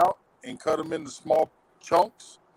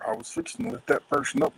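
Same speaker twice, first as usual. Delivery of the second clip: usual speaking voice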